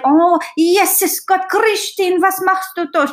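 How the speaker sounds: put-on high, fussing old-lady voice with German words; flustered German grandma. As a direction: play a panicking German Oma catching a child in the kitchen. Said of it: "And she was like".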